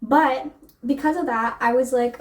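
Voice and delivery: ordinary talk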